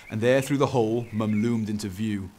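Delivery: ordinary delivery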